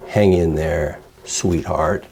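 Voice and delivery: soft, low, syrupy pity; the wrong way. Shown as a demonstration. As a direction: coo it too sweetly, the way not to. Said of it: "don't say it like that".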